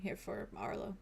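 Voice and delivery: quiet. Acted. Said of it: "He just points at Arlo and says".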